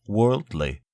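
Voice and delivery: plain reading voice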